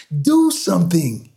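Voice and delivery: high-pitched frantic plea; screaming. A narrator's reading. Frantic man to crowd in traffic, screaming. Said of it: "he screamed".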